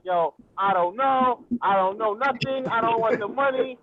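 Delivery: ordinary talk, nothing marked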